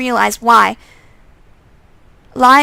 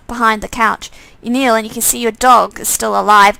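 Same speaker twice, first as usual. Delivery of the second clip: ordinary talk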